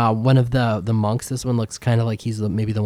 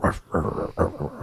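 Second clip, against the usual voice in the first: low, muffled dog ruffs; voiced dog barks, kept soft; dog barking; 5 semitones lower, 7 dB quieter than usual. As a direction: imitate a dog woofing back. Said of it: "so Marv is like".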